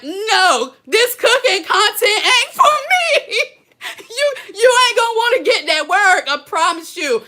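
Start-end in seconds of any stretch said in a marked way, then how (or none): 0.0-3.5 high, loud mock wail; mock quitter. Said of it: "You gonna be like". 4.0-6.3 high, loud laughing tease. Real, not acted. Laughing at the quitters.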